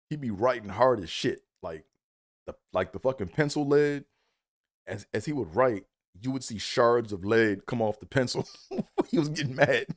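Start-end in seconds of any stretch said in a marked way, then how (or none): none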